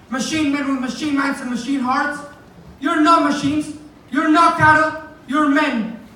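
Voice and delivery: stirring oration, building to a shout; impassioned rallying cry. Acted. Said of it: "final speech from The Great Dictator".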